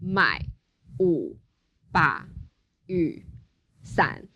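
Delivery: slow, each syllable separated by pauses; one syllable at a time; word by word. Shown as a demonstration. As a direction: say each word on its own with a gap. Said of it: "it goes".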